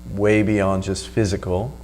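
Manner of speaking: ordinary talk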